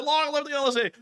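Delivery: high, hammed-up slogan shout; mock rally cheer. Hosts shouting the slogan back, high and playful. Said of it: "we'll be like".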